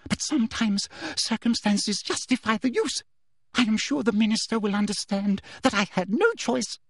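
high, prim woman's voice; self-justifying official. A narrator's reading. Teacher excusing the use of a curse, self-justifying. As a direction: justify yourself, pitched well up.

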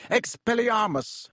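mild bellow of a spell. A narrator's reading. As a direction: hurl the spell out. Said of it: "Harry bellowed".

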